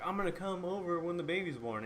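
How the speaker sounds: high, pushy mock gushing voice; mock Darcy barging in. Darcy butting into Rachel's gift moment, high mock gushing. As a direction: squeal it like an intruding friend. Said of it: "Darcy just jumps in. It's like".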